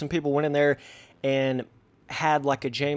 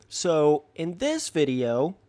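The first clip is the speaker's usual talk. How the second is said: ordinary talk, nothing marked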